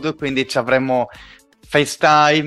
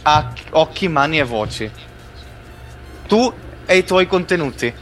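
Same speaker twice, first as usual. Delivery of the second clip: rapt, running translation of a keynote; live keynote interpretation. Acted. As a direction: narrate the launch like you're swept away. Hosts call him in trance.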